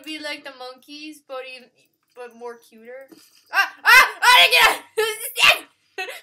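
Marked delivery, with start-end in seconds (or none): 3.5-6.1 loud, high panicky cries; real high-pitched yelling; startled yelps. Real, not acted. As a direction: yelp out in sudden alarm. Startled yelps during game.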